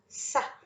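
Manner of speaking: sa said unvoiced, just the hiss and vowel; whispered syllable sa. Shown as a demonstration. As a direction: breathe out "sa" softly to show the S sound. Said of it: "você vai observar o sa". (sussurrando "sa")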